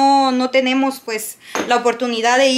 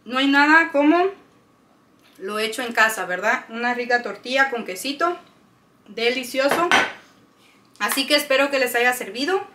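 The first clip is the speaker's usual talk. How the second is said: talking with mouth full; 3 dB quieter than usual. Real, not acted. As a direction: speak through a mouthful of tortilla. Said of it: "estoy hablando con la boca llena".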